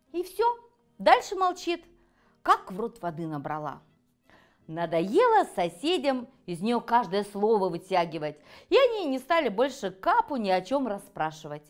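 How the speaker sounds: plain reading voice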